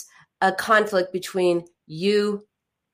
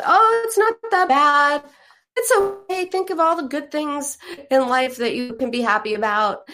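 much higher, breezy brushing-off tone; dismissive self-talk; 6 semitones higher than usual. Retold or imitated. Therapist mimics brushing off your own pain, breezy and high. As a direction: wave the pain away cheerfully. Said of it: "tell yourself".

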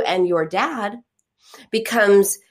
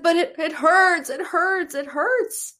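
put-on much higher, pleading child's whimper; crying little girl; 9 semitones higher than usual. Mother imitates her hurt daughter still crying, high pleading whimper. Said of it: "she said".

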